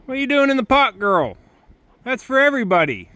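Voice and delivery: high-pitched question to an animal; pet talk. Real, not acted. Keeper asking an animal what it is doing in the pot, high pet talk.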